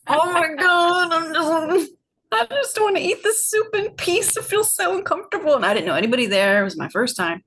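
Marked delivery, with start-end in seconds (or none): 0.1-5.6 high, squeaky mock whine of discomfort; squirmy inner voice. Awkward at a silent table, a high mock whine. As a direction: squeal it like someone dying to escape the moment.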